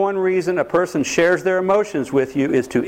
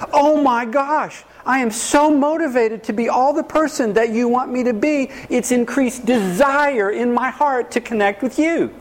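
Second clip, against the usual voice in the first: sarcastic gushing; 6 semitones higher than usual. Retold or imitated. Counselor mocking criticized partner's gratitude, sarcastic gushing. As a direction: ham up fake delight to mock the idea. Sarcastic imagined grateful response.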